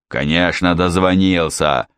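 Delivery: usual speaking voice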